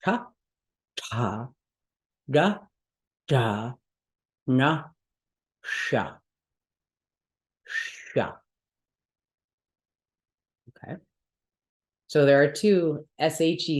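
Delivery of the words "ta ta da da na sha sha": retroflex ta da na sha, tongue curled back; curled tongue heavy consonants. Shown as a demonstration. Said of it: "the retroflex sounds sound like".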